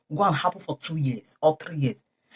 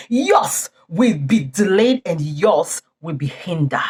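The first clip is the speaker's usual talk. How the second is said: usual speaking voice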